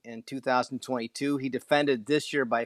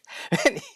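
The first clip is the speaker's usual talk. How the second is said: laugh; 13 semitones higher, 5 dB louder than usual. Real, not acted. Laughs at own bit.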